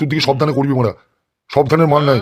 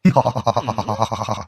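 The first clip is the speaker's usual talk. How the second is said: laughing; 4 semitones lower, 4 dB quieter than usual. Acted. Boss amused at the news.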